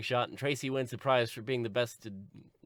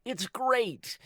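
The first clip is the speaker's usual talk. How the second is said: high, slow, stretched-out cheer; Tracy; 9 semitones higher, 3 dB louder, much slower than usual. Acted. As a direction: welcome Mama with hammed-up glee. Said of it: "And then Tracy is like".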